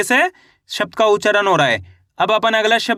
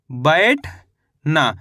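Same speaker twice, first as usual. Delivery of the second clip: "बैठना" drawn out slowly, broken by a pause before ना; syllable by syllable; 5 semitones lower, much slower than usual. Shown as a demonstration. Teacher joins up the spelled word for learners, syllable by syllable. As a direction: stretch बै in "बैठना", pause, then land ना. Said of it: "तो मिलके बन गया ये".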